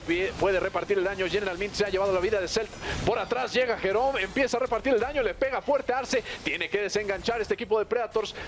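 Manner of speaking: fast, rising esports call; excited play-by-play. Real, not acted. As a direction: call the fight as it breaks out. Team fight call continues. (narración deportiva emocionada)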